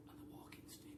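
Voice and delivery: hushed whisper. A narrator's reading. Storyteller whispering what was left of the Twits. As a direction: breathe the words out.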